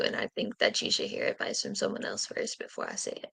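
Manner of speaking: hushed, unvoiced warning; whispering. Real, not acted. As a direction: whisper it so only she hears. Delivering a strict card message.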